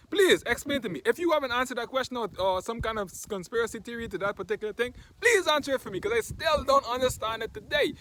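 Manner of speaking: high, loud incredulous rant; exasperated plea. Real, not acted. Vlogger begging viewers to explain the toilet tissue rush, exasperated. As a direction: plead for an answer, pitch climbing in disbelief. Mock pleading over toilet-paper hoarding.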